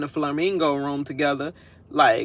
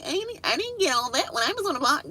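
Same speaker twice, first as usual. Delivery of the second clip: mocking houseguest; 9 semitones higher, 4 dB quieter than usual.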